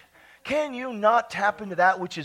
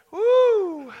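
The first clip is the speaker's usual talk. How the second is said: joyful whoop; 11 semitones higher than usual. Real, not acted. Preacher caught up in holy laughter, high joyful whoop. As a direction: whoop with joy.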